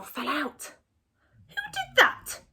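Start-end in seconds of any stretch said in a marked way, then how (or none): 1.5-2.4 very high, loud squeal; cross squirrel. A narrator's reading. Squirrel fallen on the floor, very high cross squeal. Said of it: "asked the squirrel crossly".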